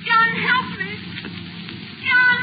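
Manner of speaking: wife begs her husband for help, growing terrified; rising panic. Acted. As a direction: call for help as fear takes hold. Wife feels herself changing.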